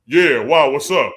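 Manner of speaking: loud, tense fake-casual hello; nervous tough greeting. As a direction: sound cool while scared stiff. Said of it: "Change your voice".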